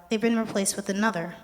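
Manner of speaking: normal talking voice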